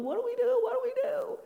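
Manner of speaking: high, frantic wail of alarm; panicked wailing. Retold or imitated. Disciple imagined wailing to Jesus in panic, high frantic wail. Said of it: "wringing his hands and wailing".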